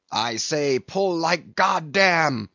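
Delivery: slow, drawn-out cry rising in pitch. A narrator's reading. Tashtego answering Stubb's challenge, crying out. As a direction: build into an emphatic call to pull. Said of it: "cried the Indian".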